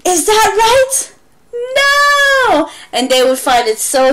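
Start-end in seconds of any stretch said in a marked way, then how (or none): 0.0-2.7 mock surprise. Shown as a demonstration. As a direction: pretend shock at the mistake. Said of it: "make a mistake like".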